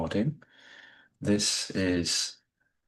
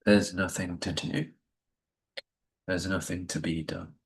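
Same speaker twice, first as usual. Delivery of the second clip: guided meditation. Acted. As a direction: ease listeners into stillness with gentle pacing. Leads a Yoga Nidra session.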